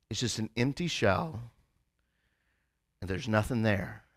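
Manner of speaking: low, soft, fading; sincere confession. Real, not acted. The preacher confesses his fear to the congregation, quietly. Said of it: "confessing his greatest fear".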